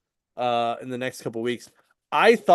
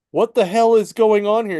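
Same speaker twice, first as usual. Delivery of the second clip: higher, louder retold bewilderment; mock alarmed outburst; 7 semitones higher, 6 dB louder than usual. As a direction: act out a startled demand for answers.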